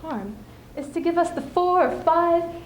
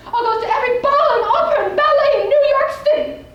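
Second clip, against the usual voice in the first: much higher and louder, thrilled; giddy excitement; 13 semitones higher, 8 dB louder than usual. Acted. Widow vowing to go to every ball, giddy and loud. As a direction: burst out with the dream of a free life. Dreams of breaking free.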